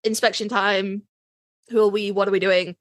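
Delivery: usual speaking voice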